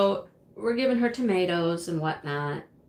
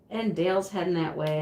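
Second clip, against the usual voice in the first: ordinary talk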